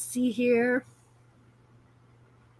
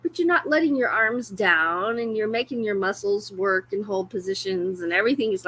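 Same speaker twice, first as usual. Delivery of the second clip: normal talking voice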